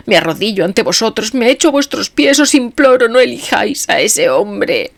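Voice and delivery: high, loud ecstatic pleading; martyr's cry. A narrator's reading. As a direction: beg like a martyr in pain. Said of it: "con la voz del mártir". (voz de mártir en éxtasis)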